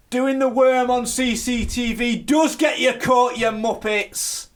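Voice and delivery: high, loud, slow telling-off; mock scolding shout. Host berates caught contestants, exasperated mock shout. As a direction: shout it slowly as if at idiots.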